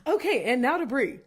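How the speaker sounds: bright anchor handoff; news anchor voice. Pretend. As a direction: toss to the next segment like TV news. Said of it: "sounded like a news reporter".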